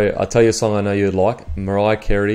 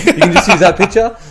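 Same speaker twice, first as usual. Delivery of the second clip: laughing; 8 semitones higher, 8 dB louder than usual. Real, not acted. Laughing repeat of own joke.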